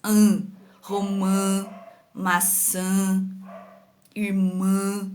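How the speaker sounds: final ã of romã, maçã, irmã hummed through the nose; drawn-out, low "An" sound ending each word; nasal tilde sound. Shown as a demonstration. Teacher demonstrating the tilde, nasal vowel held. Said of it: "dá aquele som nasal".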